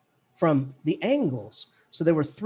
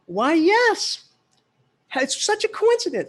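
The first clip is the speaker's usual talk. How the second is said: much higher, louder, put-on eager voice; mock eager reply; 12 semitones higher, 3 dB louder than usual. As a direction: play it up as a delighted eccentric. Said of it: "I said".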